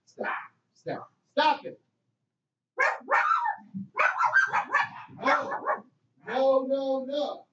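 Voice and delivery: high, distant scolding of dogs; shouting off mic. Real, not acted. Host leaves the mic to silence his dogs, distant high scolding.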